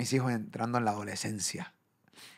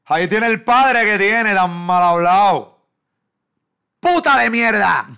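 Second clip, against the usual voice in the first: loud, high, drawn-out mock tirade; imitating angry commenters; 9 semitones higher, 14 dB louder, slower than usual. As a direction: yell it like an outraged commenter. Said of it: "gente ha puesto en los comentarios". (insultos imitados a gritos)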